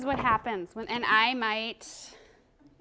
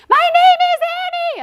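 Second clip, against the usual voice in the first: very high, loud squeal; hammed-up high-pitched blurt; squealed self-introduction; 15 dB louder, faster than usual. Trainer clowning an out-of-control moment for the group, high squeal. Demonstrating her emotional, out-of-control state.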